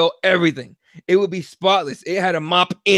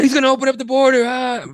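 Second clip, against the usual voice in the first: panicked voter; 5 semitones higher than usual. Retold or imitated.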